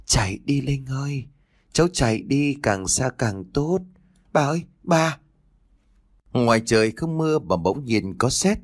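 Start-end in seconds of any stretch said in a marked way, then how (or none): 0.0-3.8 higher voice calling from afar, slowing at the end; grandmother's echoing voice. A narrator's reading. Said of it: "tiếng của bà nội vọng lên". 4.3-5.2 high plaintive call to grandma; calling out anxiously. A narrator's reading. Frightened girl calls to grandmother.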